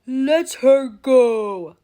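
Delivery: loud, slow roaring pirate captain; roaring like Hook. Acted. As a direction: roar it like an angry captain. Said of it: "Peter roared, still sounding like Hook".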